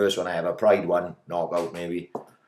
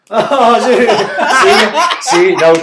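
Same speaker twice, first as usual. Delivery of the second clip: laughing burst; 12 semitones higher, 13 dB louder, slower than usual. Real, not acted. Laughing at quiz answer.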